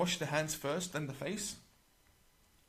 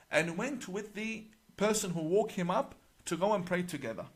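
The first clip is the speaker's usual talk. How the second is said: usual speaking voice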